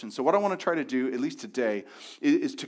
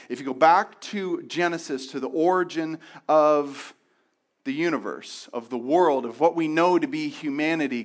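normal talking voice

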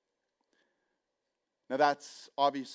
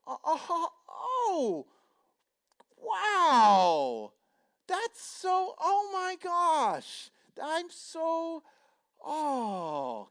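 high, halting, at a loss for words; stunned fumbling; 5 dB louder, much slower than usual. Retold or imitated. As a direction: fake delight over awkward news. Stunned reaction to engagement news.

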